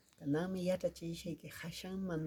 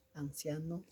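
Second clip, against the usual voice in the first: ordinary talk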